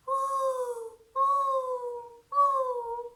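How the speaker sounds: high steady whistling woo; kettle whistle. Acted. A kettle boiling in the story, high whistle.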